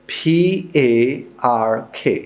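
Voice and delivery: slow letter-by-letter spelling; spelled out. Shown as a demonstration. Teacher spelling a word for students, slow and deliberate. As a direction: spell each letter out slowly. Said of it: "एक लफ्ज़ है".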